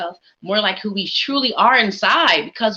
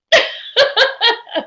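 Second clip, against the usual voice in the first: a burst of high laughter after the shout-out; high bright laugh; 13 semitones higher than usual. Real, not acted. Host laughing at the camera after greeting her aunt, a high laugh.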